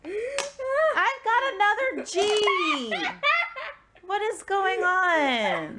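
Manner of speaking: slow, high, loud wailing complaint; drawn-out yell of frustration; exasperated wail. Real, not acted. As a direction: cry it out, stretching the words. Exasperated at drawing bad tiles.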